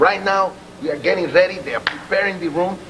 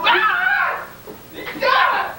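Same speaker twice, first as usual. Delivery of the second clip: high shrill scream, much higher. Acted. Man yelps as his robe is taken, high scream. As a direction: let out a high scream as they grab you.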